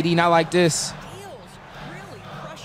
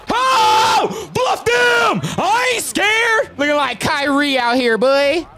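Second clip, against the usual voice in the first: much higher, loud hype yells; triumphant shouting; 6 dB louder, slower than usual. Real, not acted. Gamer hyping himself after a bluff. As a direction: scream it like you just crossed him up.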